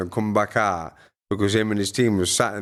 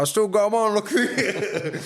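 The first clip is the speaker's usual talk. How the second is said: cheeky; 11 semitones higher than usual. Retold or imitated. Retelling his cheeky reply to Lawrence.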